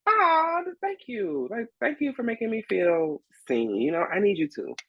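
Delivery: high, slow gushing thanks; mock touched. Thanking the stage manager, high gushing coo. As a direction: gush like she paid a compliment.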